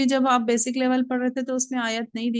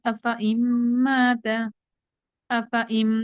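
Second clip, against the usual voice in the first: slow, drawn-out tajweed recitation; Quran recitation; slower than usual. Shown as a demonstration. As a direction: chant the verse slowly with care. Teacher recites Quran verse. (धीमी तजवीद वाली तिलावत)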